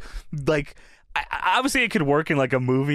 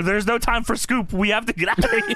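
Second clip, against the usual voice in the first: raised-pitch urgent mock yell; retold yell; 6 semitones higher than usual. Host retelling wrestler fleeing the interviewer, mild mock yell. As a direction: hurry her off, only half yelling. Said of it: "He's yelling at her".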